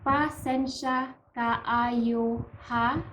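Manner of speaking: slow, drawn-out "Pasensya kaayo ha", rising at the end; slow demonstration. Shown as a demonstration. Teacher models the next apology for learners, slow demonstration. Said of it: "The next one is".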